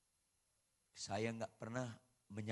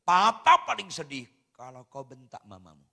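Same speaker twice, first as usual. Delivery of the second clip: stern warning; 17 dB louder than usual. Retold or imitated. Father warns his children.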